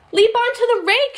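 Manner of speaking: high loud urgent call; shouted. Acted. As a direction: yell it up to the cat in the tree. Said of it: "shouted Ella".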